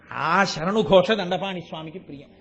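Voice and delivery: usual speaking voice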